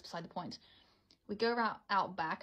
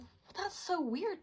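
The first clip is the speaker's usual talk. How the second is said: shaky; 10 semitones higher, slower than usual. Retold or imitated. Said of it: "I was, like, shaky".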